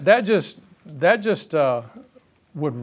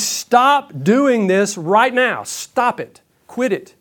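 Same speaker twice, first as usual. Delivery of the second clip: slow, raised-pitch insistence; firm, urgent scolding; emphatic command; 5 semitones higher, much slower than usual. Acted. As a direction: order it stopped, each phrase set apart. Voicing the urgent command of Jesus.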